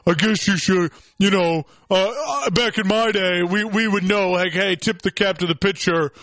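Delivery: lightly voiced grumpy old baseball man; mock the old guard's complaining; old-timer griping. Host mocking old managers over the grand slam, grumbling old-timer voice. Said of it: "the old guys in baseball going".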